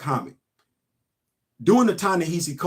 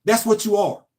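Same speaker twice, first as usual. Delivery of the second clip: ordinary talk, nothing marked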